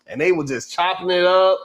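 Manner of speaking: usual speaking voice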